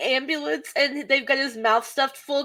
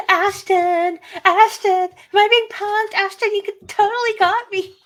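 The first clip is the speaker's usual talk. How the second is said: higher, louder excited starlet; ditzy character quote; 7 semitones higher, 5 dB louder than usual. Retold or imitated. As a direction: gush at him like a giddy starlet.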